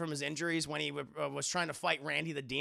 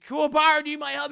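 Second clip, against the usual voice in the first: high, loud cartoonish shout to a friend; calling out; 8 semitones higher, 10 dB louder, faster than usual. Worried friend offering the hero help, high urgent call. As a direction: throw the name out high and urgent.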